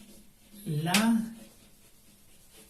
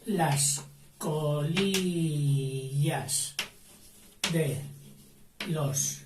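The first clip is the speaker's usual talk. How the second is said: slow dictation; much slower than usual. Shown as a demonstration. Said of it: "dictation word by word".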